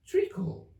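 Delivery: high, slow little Dormouse. Acted. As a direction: answer slowly in a high small voice.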